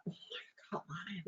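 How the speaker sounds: whispered aside. Real, not acted. Admitting the lie to the audience, whispered. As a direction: breathe the confession.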